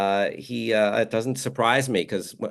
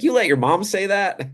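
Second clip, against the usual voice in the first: higher, amused disbelieving question; mock incredulous celebrity voice; [name] impression; 10 semitones higher than usual. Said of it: "He's like".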